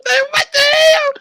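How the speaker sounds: very high, loud, slow what the hell; outraged disbelief. Real, not acted. Vlogger reacting to the man's request, a high loud squeal.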